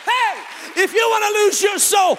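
fiery revival preaching; full-force preaching. Acted. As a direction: preach it at the top of your lungs to the congregation.

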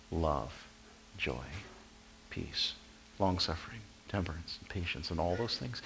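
low, very soft, spaced-out words; quiet recital with pauses between items; hushed listing. Acted. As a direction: count them off gently, almost to yourself. Reviewing virtues in thought.

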